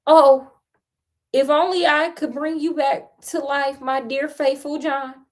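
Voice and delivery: high, lightly acted lament; storybook grief without real tears; weeping lament. A narrator's reading. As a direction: open on a loud oh, then plead high. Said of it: "he wept and said".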